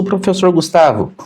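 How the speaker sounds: usual speaking voice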